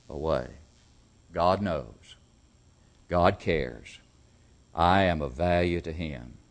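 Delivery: usual speaking voice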